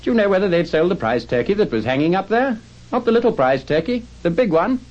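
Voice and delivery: high, excited questioning call. Acted. Scrooge asking the boy about the prize turkey, excited high call. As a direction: call down eagerly, pitch climbing.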